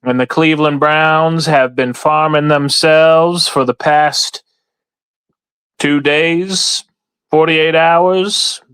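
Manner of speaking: hammed-up Southern farmer, stretched out. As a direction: spin a yarn like a Southern gentleman farmer. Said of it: "I can't keep doing the voice too long".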